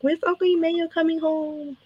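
put-on high, soft little-girl question; imitating young niece. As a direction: put on a small child's plaintive high voice. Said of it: "she'll be like".

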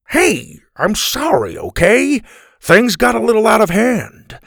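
high, protesting voice; defensive apology. Acted. Grandpa answering Grandma's smack on the shoulder, defensive.

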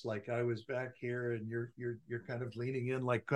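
very faint, as if far from the mic; distant bad audio. Shown as a demonstration. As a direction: demo poor sound from way back in the room. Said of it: "my audio sounded like this".

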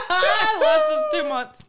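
laughing. Real, not acted. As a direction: squeal it out through laughter. Laughing at cheap coffee tub.

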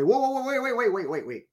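high, hurried whoa wait; retold urgent interruption. Pastor cutting off the husband's reading, urgent. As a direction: stop him mid-sentence.

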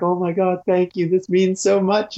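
a lightly hammed-up grateful brother; gushing thanks, slowing at the end; emotional brother. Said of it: "And he's like".

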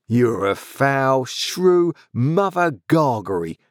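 slow, drawn-out surly growl; growled insult. A narrator's reading. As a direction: growl each word with contempt. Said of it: "growled the journeyman".

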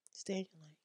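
breathy whispered warning; whispered reminder. Real, not acted. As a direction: hiss it softly as a secret. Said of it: "whispered repeat".

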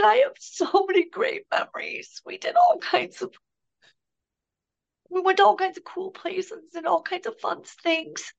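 high, half-whispered through tears; tearful whisper. Retold or imitated. Son crying to his mother, whispering high and tearful. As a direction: breathe the words out as if crying. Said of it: "and then he starts crying".

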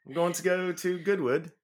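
amateur London accent, drawn out; East End London accent. Shown as a demonstration. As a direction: attempt British, landing in East End London. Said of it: "Sound like you're from the East End of London".

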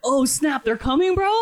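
high, loud playing-along astonishment; mock alarm. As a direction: act shocked to humour the old man.

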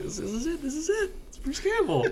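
very high, thrilled, laughing; giddy fan squeal; excited squeal. Retold or imitated. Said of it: "I was like".

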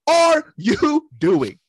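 high, loud, drawn-out yell of disbelief; mock scream at the TV. Fan yelling at the TV during the fight, loud and high. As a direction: yell at the screen in outrage. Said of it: "I was screaming at the T V".

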